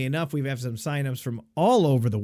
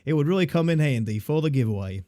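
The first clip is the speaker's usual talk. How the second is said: mock Australian accent, much higher on the first words; 3 semitones higher than usual. Host finishes Dan's note in a high mock Australian accent. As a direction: sign off the note as a cheery Aussie fan. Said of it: "read it in an Australian accent".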